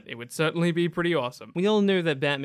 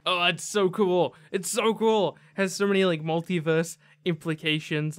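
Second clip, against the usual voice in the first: excited; 4 semitones higher, 4 dB louder than usual. Real, not acted. Gushing over the clip.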